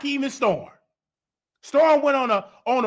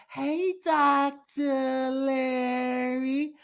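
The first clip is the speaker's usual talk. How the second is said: very slow, softer drawn-out greeting; mock caller answering; 3 semitones higher, 6 dB quieter, much slower than usual. Answering the doctor's call, slow and soft. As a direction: stretch the hello lazily. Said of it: "get you back on the phone".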